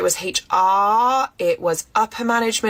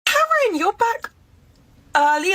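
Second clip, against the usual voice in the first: surprised; 13 semitones higher than usual. Acted. Surprised at boss's return.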